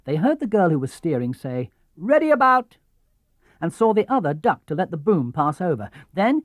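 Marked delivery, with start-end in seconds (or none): 2.0-2.8 high, loud call across the water; called command. Acted. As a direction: shout the tacking order to the crew. Girl steering calls sailing command.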